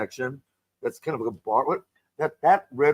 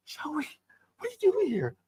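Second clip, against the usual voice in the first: retold surprise; 13 semitones higher, 5 dB quieter than usual. Retold friend surprised to see her.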